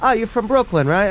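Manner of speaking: high-pitched street approach of a stranger; eager stranger. Retold or imitated. Stranger stopping a man in Union Square Park, eagerly imitated. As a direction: imitate an excited guy stopping a passerby. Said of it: "stopped by a guy who I didn't know".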